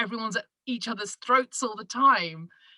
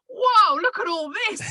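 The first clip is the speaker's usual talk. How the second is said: high, loud "whoa" of astonishment; amazed gasp; 9 semitones higher, 7 dB louder than usual. Retold or imitated. Visitor in a hunting store, loud high amazement. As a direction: gawp at a wall of guns. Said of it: "I was like".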